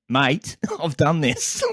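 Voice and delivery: fed-up caller half-laughing at tech support; laughing exasperation. Retold or imitated. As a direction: laugh through your irritation, drawing it out. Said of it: "You see me getting pissed off".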